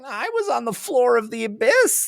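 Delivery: very high, silly crazed god; high-pitched cartoon raving, speeding up; dying god's crazy voice. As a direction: rattle it off in a squeaky mad voice. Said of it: "crazy voice".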